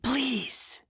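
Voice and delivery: high, soft begging; frightened man begging the stranger; desperate plea. A narrator's reading. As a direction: beg quietly. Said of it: "Lenny whispered".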